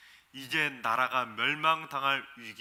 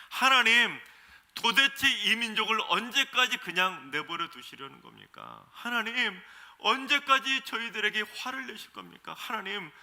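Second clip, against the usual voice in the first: 높고 큰 소리로 하나님께 따지듯; 억울함을 쏟아내며 크게 항의하는 기도; 화내듯 호소; 6 semitones higher, 8 dB louder than usual. Retold or imitated. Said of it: "마치 화를 내는 것처럼". (하나님께 화를 내듯 크게 따지는 백성의 기도)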